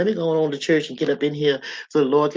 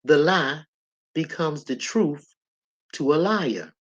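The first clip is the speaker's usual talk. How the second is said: slow, spaced out in short phrases; slow it down, pausing after "lie" and "truth"; measured repeat for emphasis; said slowly; slower than usual. Shown as a demonstration. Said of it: "Let me say that slow".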